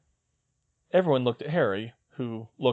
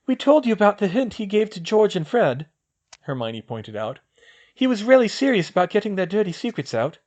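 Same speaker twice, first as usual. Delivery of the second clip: put-on higher young woman's voice, brisk; girl's voice; 9 semitones higher, 5 dB louder than usual. Hermione planning with Luna, in a higher girl's voice. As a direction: voice the girl, a clear step up in pitch.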